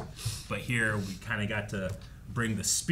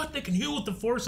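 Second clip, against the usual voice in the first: much higher, incredulous squeak; surprised fans; 14 semitones higher than usual. Retold or imitated. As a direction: play the stunned fans.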